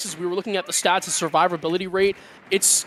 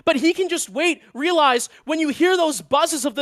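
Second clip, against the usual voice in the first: esports caster; 8 semitones higher, 3 dB louder than usual. Real, not acted. Co-caster still hyped after clutch.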